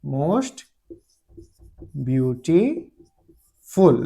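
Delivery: beautiful stretched very slowly, word by word; dictating; slow dictation. Shown as a demonstration. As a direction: drag out most beautiful for writing down. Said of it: "इसका superlative हो जाएगा".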